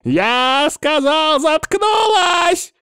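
high, slow enraged scream; furious roar. Acted. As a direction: scream it, losing control. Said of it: "рявкнул лекарь".

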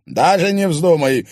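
loud, firm refusal; husband firmly overruling his wife, loud. Acted. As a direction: shut the idea down hard. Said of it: "твёрдо сказал ей Иван".

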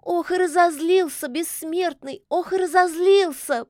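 high-pitched fretful lamenting; high, anxious kikimora wail. A narrator's reading. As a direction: fret aloud in a high voice. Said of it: "причитала Кикиморка".

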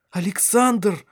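high, startled cry of his name; exclaiming. A narrator's reading. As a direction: call out in sudden amazement. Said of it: "воскликнула она". (восклицая, высоко и взволнованно)

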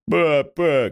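high call to dad; high relieved child's call. A narrator's reading. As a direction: cry out for her father. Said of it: "кричить Піпа".

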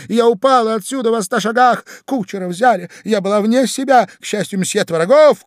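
high, loud, breakneck feminine chatter; gushing society wife. Acted. Wife invents an accident for her husband, gushing excitedly. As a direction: pour out the tale excitedly, pitched high.

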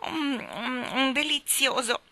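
high, relishing hums; savouring. A narrator's reading. Boy tastes his first ice cream, savouring. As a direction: savour it with drawn-out mms.

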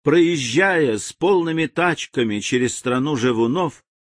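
usual speaking voice

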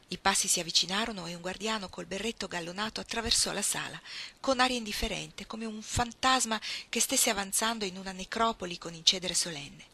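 ordinary delivery

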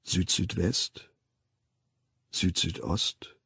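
low, soft, spaced-out compass points; slow hushed count. A narrator's reading. Narrator following the hanging feet turning, slow and hushed. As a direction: let each point fall quietly, with long pauses. Feet slowly swinging back.

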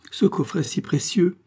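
high-pitched dismay; distraught exclamation. A narrator's reading. Farmer clutching his hair over the stolen casket, distraught. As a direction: lament the loss, voice lifted. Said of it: "s'écria Billaud en enfonçant les mains dans ses cheveux".

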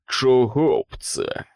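slow, jeering taunt; a boot sneering at the cornered pig; mocking sneer. A narrator's reading. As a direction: grin nastily as you drawl it out. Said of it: "глузливо вишкірився".

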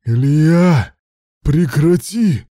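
high, loud desperate shout. A narrator's reading. Man in unbearable pain orders Ilya to stop, shouting. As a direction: shout it out, drawing the words out. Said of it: "закричал он".

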